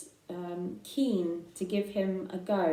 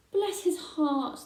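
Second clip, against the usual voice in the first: higher, fond sheep voices crying out; cried out; 8 semitones higher, slower than usual. Acted. The sheep fuss over Babe, crying out fondly. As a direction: coo over him like old ewes. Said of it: "they cried".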